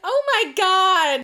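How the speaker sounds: drawn-out, high, loud gasp of disbelief; real slow stunned oh my God; shocked. Real, not acted. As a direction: react as if you can't believe it. Shocked by the cork story.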